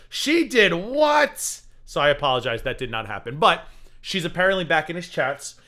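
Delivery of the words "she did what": surprised. Retold or imitated. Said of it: "I said".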